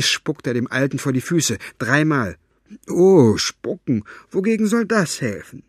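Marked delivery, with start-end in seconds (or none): 2.9-5.6 mocking. Fenoglio mocking.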